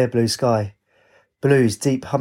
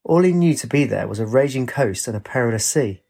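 ordinary delivery

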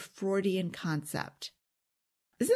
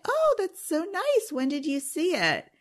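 innocent cheerful reply; 7 semitones higher than usual. Retold or imitated. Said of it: "And I'd say".

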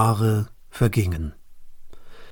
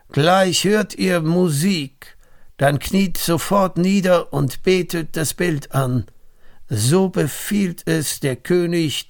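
high, carrying herald's proclamation; herald calling; 5 semitones higher than usual. Acted. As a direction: announce the king's order like a herald to the crowd. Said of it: "Da rief der Herold des Königs".

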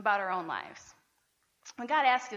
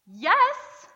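sharp, loud, higher snapped yes; angry retort; 9 semitones higher, 12 dB louder than usual. Retold or imitated. Jonah retorting to God, mock angry snap. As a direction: bite back at God. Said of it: "Jonah retorted".

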